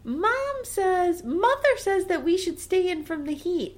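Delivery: high-pitched mock child voice; whining children. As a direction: imitate kids hiding behind mother's word. Children answering back, quoting their mother.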